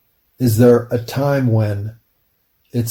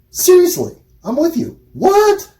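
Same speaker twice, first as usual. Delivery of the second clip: incredulous; 3 dB louder than usual. Real, not acted. Incredulous aside.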